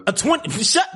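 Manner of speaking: very high, broken-off exclamation; shocked disbelief. Real, not acted. Host hearing the flight is twenty hours, shocked disbelief. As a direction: blurt it out, stunned.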